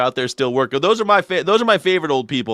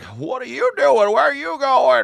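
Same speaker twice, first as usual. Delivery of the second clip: put-on old Uber driver; 11 semitones higher than usual. Comedian imitating elderly Uber driver to passenger, high old voice. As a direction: ask like a lost elderly driver. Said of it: "He's like".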